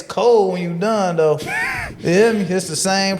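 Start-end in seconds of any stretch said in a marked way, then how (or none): 1.4-1.9 sudden high laughing yelp; sharp delighted laugh; burst of laughter. Real, not acted. Laughing at his own joke.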